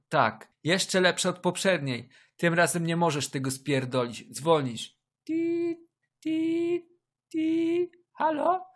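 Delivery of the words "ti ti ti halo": high-pitched beeping ring tone; imitated phone ring. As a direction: beep like a dial tone.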